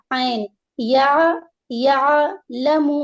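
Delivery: عین کو حلق سے واضح; clear throat ain. Shown as a demonstration. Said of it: "عین حلق سے ادا کریں گے".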